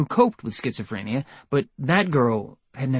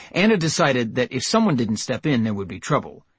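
plain reading voice

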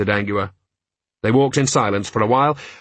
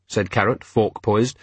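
plain reading voice

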